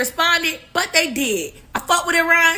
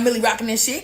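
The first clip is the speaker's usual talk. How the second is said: normal talking voice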